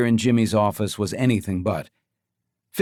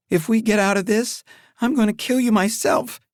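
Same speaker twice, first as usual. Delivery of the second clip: furious; 11 semitones higher than usual. Acted. Furious at brother during chase.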